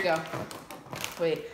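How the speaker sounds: usual speaking voice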